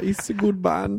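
put-on high-pitched German accent; an impish little German voice; mischievous German. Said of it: "it's, like, mischievous German".

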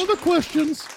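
high-pitched mimicking falsetto; Julia Child. Host echoing his filtered Tiny Trump clip, high-pitched mimicry. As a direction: parrot it back in a very high voice. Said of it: "it's a little bit Ju-, like Julia Child".

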